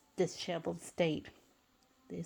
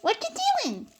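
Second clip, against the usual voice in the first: high, bright-voiced friendly greeting; a much higher character voice, cheerful and welcoming; friendly Sandy voice; 12 semitones higher, 5 dB louder than usual. A narrator's reading. Sandy greets SpongeBob after days apart, friendly high voice. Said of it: "she asked in a friendly tone".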